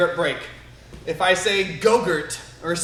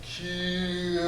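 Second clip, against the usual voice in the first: soft, long drawn-out kiai; slow-motion kiai; 8 dB quieter than usual. Shown as a demonstration. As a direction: let the yell ooze out in slow motion. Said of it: "got the slow motion kia too".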